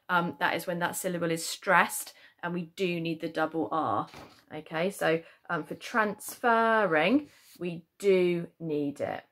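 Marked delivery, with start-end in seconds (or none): 5.8-7.3 "transferring" slow, with the "fer" drawn out; stress on the FER. Shown as a demonstration. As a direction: lean hard and long on the second syllable of "transferring". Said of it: "we say transferring".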